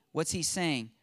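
ordinary talk

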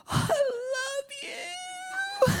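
very high, slow, crying-out I love you; gushing love. Retold or imitated. To strangers at the party, a high sobbing gush. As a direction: gush it out like a tearful parent.

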